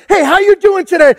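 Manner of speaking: mock churchy small talk with a plastic smile; lightly hammed-up fake cheer; fake cheery church greeting. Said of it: "happy church smile on".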